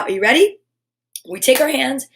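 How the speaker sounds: normal talking voice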